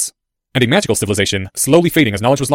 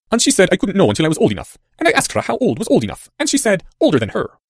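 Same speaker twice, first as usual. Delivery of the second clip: put-on higher-pitched, singsong boy; boy informant; 8 semitones higher than usual. A narrator's reading. Boy quotes his mother to the stranger, higher voice. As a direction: recount it in a raised youthful voice.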